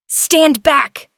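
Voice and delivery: loud sharp warning; yell. A narrator's reading. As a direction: yell to clear the way. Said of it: "Elias yelled".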